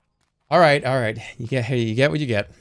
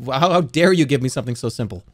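ordinary talk

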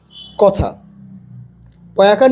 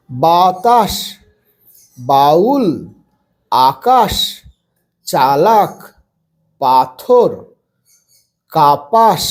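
slow word list, each syllable held; broken into syllables; 4 semitones lower, much slower than usual. Shown as a demonstration. Teacher reading practice words for beginners, syllable by syllable. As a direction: read word by word, syllables pulled apart. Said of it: "ভেঙে ভেঙে পড়বে".

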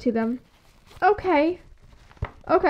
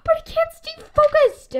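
scared; 11 semitones higher than usual. Acted. Nervous girl before meeting idol.

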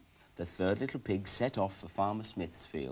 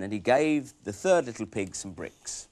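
ordinary delivery

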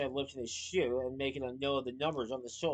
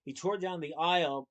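ordinary delivery, nothing marked

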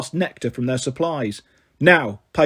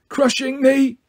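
strained, higher squeezed voice; squeezed too tight, pitched up and strained; groaning complaint; 8 semitones higher, slower than usual. A narrator's reading. Hugged too hard, he groans a high strained complaint. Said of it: "until he groaned".

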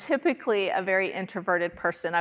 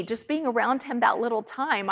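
ordinary talk, nothing marked

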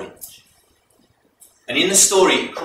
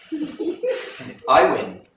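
very slow and higher, a gloating king with a laugh in it; king's dice challenge; 7 semitones higher, 5 dB louder, much slower than usual. Acted. King challenging his wife again, slow and gloating. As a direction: stretch it out teasingly as a gambling king.

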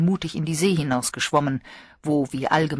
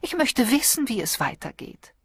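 a touch of high petulance; lightly voiced whiny young woman; mild whining; 9 semitones higher than usual. A narrator's reading. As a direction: plead lightly like a spoiled girl. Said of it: "jammerte Jane".

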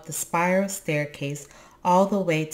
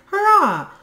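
very high, loud cheer; jubilant cry; 11 dB louder than usual. A narrator's reading. Little creature celebrates news of Moominpapa, high jubilant cry. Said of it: "jumped up and down and cried".

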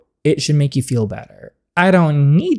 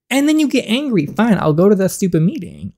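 exasperated; 7 semitones higher than usual. Acted. Exasperated giving in to father.

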